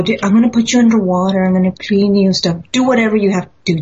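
fast, rushed promises to the rock; hurried. Retold or imitated. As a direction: rattle it off to get it over with. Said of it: "and I go".

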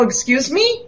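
startled question. Retold or imitated. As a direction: whip round, caught off guard. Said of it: "turn around and go".

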